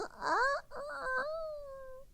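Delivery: squeaky, very high strangled croak; a nervous rooster who can't get the crow out; failed crow. Retold or imitated.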